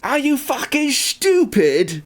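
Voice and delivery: mock British outrage; British "schtupid". Shown as a demonstration. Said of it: "like a S and then a C H".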